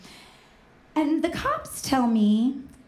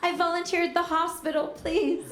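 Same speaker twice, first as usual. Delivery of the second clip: high, pleading mock-crying; hammed-up sobbing apology, no real tears; tearful apology; 5 semitones higher than usual. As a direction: beg forgiveness in a pitched-up whine. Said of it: "I just burst into tears".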